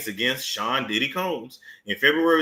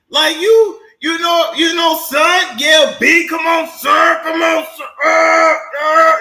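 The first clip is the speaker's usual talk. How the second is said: high shouting come on sir; loud New Yorker yell; 12 semitones higher, 7 dB louder than usual. Retold or imitated. Imitating [name] and [name], high loud hollering. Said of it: "sound like he's screaming at you".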